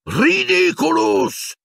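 very high, slow, drawn-out roar of the spell. A narrator's reading. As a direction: bellow the spell as you leap forward. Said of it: "ruggì Lupin".

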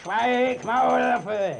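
loud, high barked order; angry shout. Acted. Judge shouting down the accused youth, loud and angry. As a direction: slap him down with one word.

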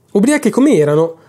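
ordinary delivery, nothing marked

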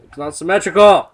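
loud exasperated cry; frustrated shout. Real, not acted. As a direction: yell it in frustration. Frustrated building complaint.

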